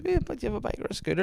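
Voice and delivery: put-on chirpy old woman pressing again; a hint of a chirpy old lady; old woman asking. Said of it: "she goes".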